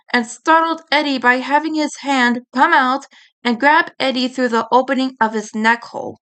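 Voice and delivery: ordinary delivery, nothing marked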